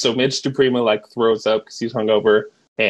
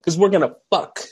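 raised, hyped-up coach speech; rally the team, voice pitched up; manic coach impression; 6 semitones higher than usual. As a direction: coach promising to crush every team, pitched up. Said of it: "So he's like".

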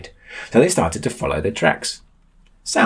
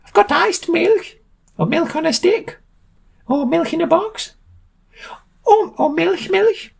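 very high screechy milk seller; piercing high character voice, item by item; Frau Milk, eager seller; 3 dB louder than usual. A narrator's reading. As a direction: squeal out the list in a very high voice. Frau Milk lists her wares.

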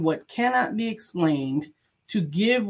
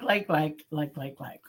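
softer and softer, dying away; fading echo; 2 semitones lower, 5 dB quieter than usual. Real, not acted. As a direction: let the chant fade out like an echo. Chant trailing off into echo.

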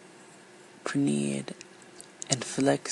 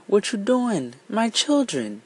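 higher, louder frightened cry; woman cries out; 8 semitones higher, 3 dB louder than usual. Acted. The woman cries to the elephants, higher and louder. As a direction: cry out in alarm for the children. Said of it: "The woman cried".

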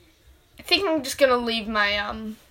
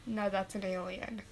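normal talking voice

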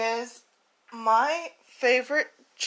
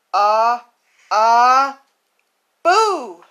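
shouted boo; 8 dB louder, much slower than usual. Acted. Mouse jumps out of his house to scare elephant, loud shout. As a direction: jump out and holler it. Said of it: "shouted a gray little mouse".

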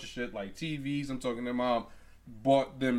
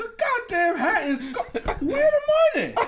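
very high, loud angry-mom impression; hammed-up squealing indignation; mock outraged screech; 6 dB louder than usual. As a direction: shriek the complaint in comic fury. Imitating mom demanding the money.

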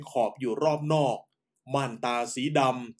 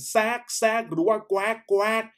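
higher-pitched imitated owl cry; barn owl call; 8 semitones higher than usual. Narrator demonstrates the barn owl's night cry, higher ringing imitation. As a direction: mimic the owl's ringing screeches. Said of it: "นกแสกร้องเสียงดังกังวาน".

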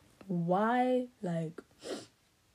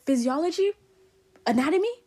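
raised, pointed questions climbing at the end; higher, louder rhetorical questions; sarcastic quizzing; 6 semitones higher, 7 dB louder, faster than usual. Real, not acted. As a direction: quiz them loudly with mock curiosity. Sarcastic quizzing of imagined opponent.

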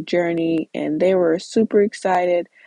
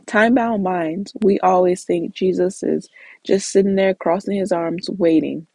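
normal talking voice

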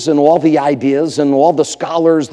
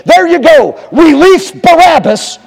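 high, loud pulpit yell; full shout; 8 dB louder than usual. Acted. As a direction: bellow it as the mob's demand. Preacher shouts the crowd's demand.

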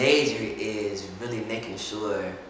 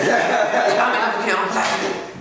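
mock aggressive rapper delivery, higher and louder, laughing; 10 semitones higher, 5 dB louder, slower than usual. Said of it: "He said that in his aggressive voice".

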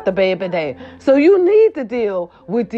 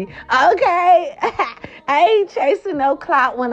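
laughing; 7 semitones higher, slower than usual. Real, not acted. Laughing through her own words.

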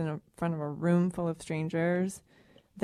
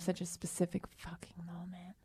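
whispering; 12 dB quieter than usual. Real, not acted. Half-joking aside.

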